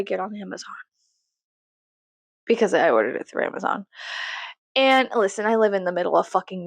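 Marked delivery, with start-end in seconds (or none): none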